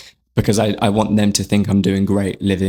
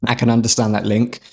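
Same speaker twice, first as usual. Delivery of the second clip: ordinary talk, nothing marked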